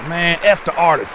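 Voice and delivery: a playful fake diss; teasing jab at the artist; mock dismissive. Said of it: "I'm just playing".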